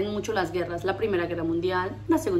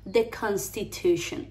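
slow, evenly spoken teacher model; measured, syllable-by-syllable delivery; slow model pronunciation; slower than usual. Shown as a demonstration. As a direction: say it slowly for learners. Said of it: "Repitan conmigo".

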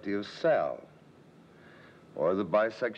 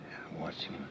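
hushed watching; 3 semitones lower, 11 dB quieter than usual. Retold or imitated. He watches secretly while feigning sleep.

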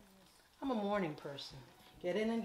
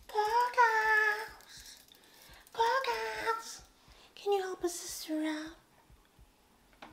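high, loud sing-song call; 9 dB louder, much slower than usual. Pretend. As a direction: shout the salon name like a cheer. Calling out the salon name.

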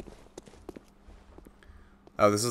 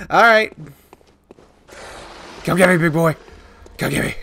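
louder, higher taunt; mocking taunt; 6 semitones higher, 7 dB louder than usual. Daring the werewolf in game, loud mock taunt. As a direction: goad the beast to come at you.

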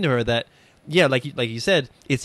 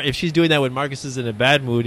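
normal talking voice

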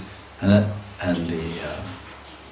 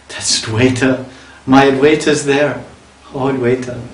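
longing call; 6 semitones higher than usual. Acted. Gauranga calls out to Advaita.

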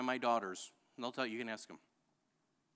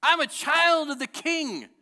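loud, high, slow proclaiming shout; slow triumphant shouted confession of faith; shouting declaration; 15 dB louder, slower than usual. Shown as a demonstration. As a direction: shout every word like a battle cry. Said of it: "get up and shout louder".

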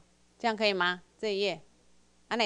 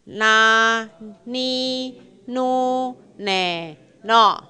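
loud, drawn-out kana syllables; syllable drill; 7 dB louder, much slower than usual. Shown as a demonstration. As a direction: call each syllable out clearly for the class. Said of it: "来，". (带读假名，每个音拉长念)

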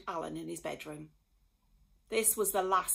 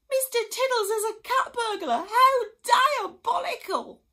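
hammed-up horror at the discovery; shocked outrage; 12 dB louder than usual. A narrator's reading. Harry discovers cat burglar.

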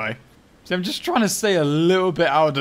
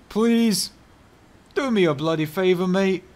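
pleading; 5 semitones higher, 4 dB louder than usual. Real, not acted. Begging AI teammate not to chase.

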